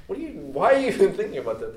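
loud, high-pitched incredulous retort; mock exasperation. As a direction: imitate someone baffled by a clueless question. Jesus' reaction to disciples retold.

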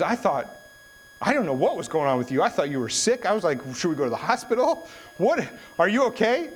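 high-pitched, worked-up imitation of dad; exasperated dad. Dad who thought son was sick, exasperated. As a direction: let the relief burst out as exasperation. Dad's retold relieved exasperation.

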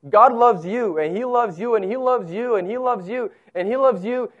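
assured, insistent refrain; confident. Shown as a demonstration. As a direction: say it with conviction, each repeat rolling into the next. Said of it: "you can say it with confidence".

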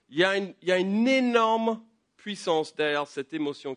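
slow, loud, high pulpit emphasis; preaching at full force. Acted. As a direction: hammer home the power of anger. Preaches on anger's power. (prédication appuyée, lente et forte)

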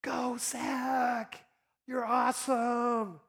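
high, loud cheer from the stands; proud dad shouting from the bleachers. Retold or imitated. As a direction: yell encouragement to your son at his game. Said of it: "and I'm there going".

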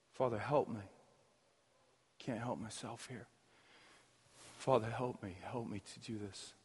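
soft, humble plea to God; mock quiet prayer. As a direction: pray it quietly, like a helpless child asking its father. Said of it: "just praying".

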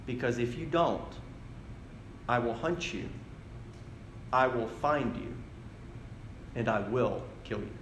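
lightly imitated quiet threat, pause after each promise; Liam Neeson. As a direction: deliver each vow separately, understated. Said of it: "I remember what Liam Neeson says next".

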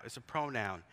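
usual speaking voice

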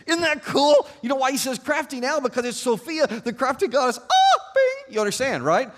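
high, loud burst of delight; excited, high-pitched exclaiming; giddy excitement. Real, not acted. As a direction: geek out loudly over a clever find. Preacher delights in wordplay.

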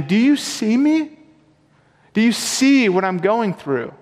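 high, slow pleading Do you see me; pleading prayer. Pretend. Preacher voicing a lamenting believer's plea, high and slow. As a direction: cry out to God asking to be seen.

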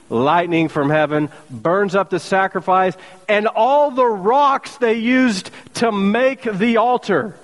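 usual speaking voice

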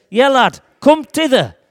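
loud Yorkshire call across the waves. Acted. Jesus calling Peter out onto the water, loud. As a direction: shout the invitation over the noise. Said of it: "Jesus shouts out".

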